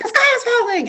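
high, loud headless-chicken panic; squealing alarm; panicked shriek. Retold or imitated. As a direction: shriek it like the world is ending. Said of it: "I'm like a headless chicken".

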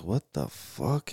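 slow, drawn-out baffled question; retold bewilderment. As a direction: stretch it out in mock bewilderment. Retells feeling himself float.

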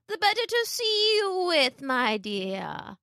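high fake granny gliding down; wolf as grandmother. A narrator's reading. Wolf toying with Little Red, high falling voice. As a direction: start high and slide down slyly.

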